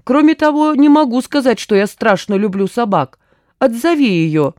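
starts high and loud, then eases; agitated complaint. A narrator's reading. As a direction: plead to be rescued. Gurkin pleads under the dog. (взволнованно жалуется)

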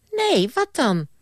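put-on very high, fast indignant boy; childish Bram. Bram brushing off the ghost, very high childish voice. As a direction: squeak it back like a defiant kid.

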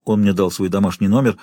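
plain reading voice

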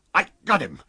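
high, effortful yell; choking shout. A narrator's reading. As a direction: shout it while grappling. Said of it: "shouted Jaffers, choking and reeling".

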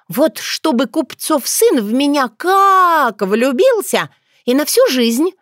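put-on high, gushing, drawn-out girlish wish; eager dreaming. A narrator's reading. Dunya wanting a love spell on the merchant's son, gushing high. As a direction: gush with it, stretching the key word. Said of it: "Глаза Дуни загорелись".

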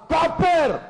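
loud, high, hard shout; too harsh. Shown as a demonstration. Preacher shows what was called too harsh, loud hard shout. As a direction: fire the word out far too harshly. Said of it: "Ustadz ngomongnya terlalu keras".

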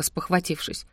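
ordinary delivery

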